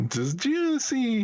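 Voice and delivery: high, slow, loud belt announcer; transformation belt voice. Retold or imitated. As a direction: stretch it out like a toy belt. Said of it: "it would just sound like the, the Gav belt".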